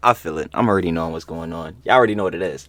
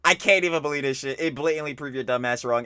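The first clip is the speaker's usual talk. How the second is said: usual speaking voice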